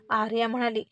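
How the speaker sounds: usual speaking voice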